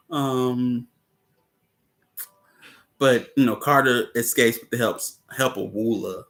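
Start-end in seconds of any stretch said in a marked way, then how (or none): none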